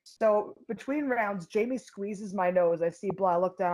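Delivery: usual speaking voice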